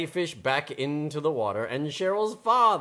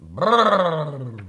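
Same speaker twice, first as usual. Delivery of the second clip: a cold, rattling brrr; shivering from the icy cold; 5 dB louder than usual. Acted. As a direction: shiver out loud. Shivering on the ice.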